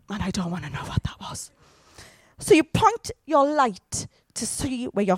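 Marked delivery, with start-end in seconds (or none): 0.1-1.5 fast, hushed whisper of dread; fearful whisper. Acted. Someone who stepped in something in the dark, whispering nervously. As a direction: whisper it so you don't have to know. Acting inner thought in dark.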